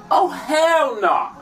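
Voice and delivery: high, slow horrified shout; retold scream. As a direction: cry it out in shock. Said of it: "I was screaming and shit".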